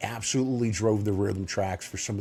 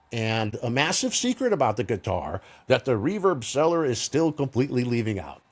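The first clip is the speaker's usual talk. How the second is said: ordinary talk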